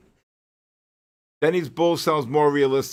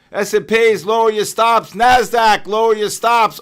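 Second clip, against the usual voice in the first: loud, higher, urgent warning to the traders; urgent calling out; 6 semitones higher, 10 dB louder than usual. Real, not acted. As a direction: shout the warning across the room. Calling out market warnings to chat.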